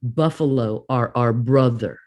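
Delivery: lightly voiced deep male voice speaking in her head. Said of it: "from a deep male voice that said".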